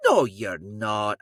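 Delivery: slow, drawn-out Scottish contradiction; mock-stern, stretched-out Scottish denial; disbelieving Scottish mother. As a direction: call the bluff as a Scottish mum. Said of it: "a four-foot-tall Scottish lady".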